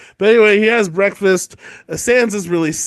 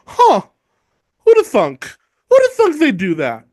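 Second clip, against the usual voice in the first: baffled Ned; 7 semitones higher, 5 dB louder than usual. Retold or imitated.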